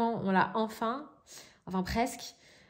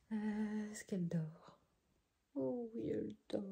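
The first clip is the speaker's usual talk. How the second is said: whispering over a crib; 2 semitones higher, 8 dB quieter than usual. Real, not acted. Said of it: "je vais pas parler trop fort".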